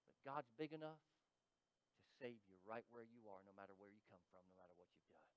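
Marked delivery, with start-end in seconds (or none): none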